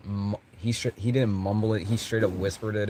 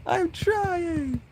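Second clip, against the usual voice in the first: high mock whimper. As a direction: squeak it out, tears fading. Repeat of high plea.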